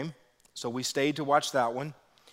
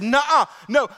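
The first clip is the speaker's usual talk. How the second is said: defiant retort; 9 semitones higher, 9 dB louder than usual. Retold or imitated. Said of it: "I doubled down on her".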